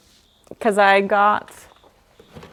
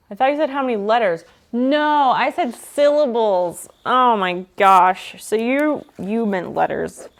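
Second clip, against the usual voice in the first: ordinary delivery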